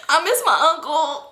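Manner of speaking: high, slow mock whine; drunken crying. Drunk friend crying at sight of police, imitated slow whine. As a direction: whimper it out in imitated tears. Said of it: "Toxic start crying".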